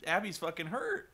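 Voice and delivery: usual speaking voice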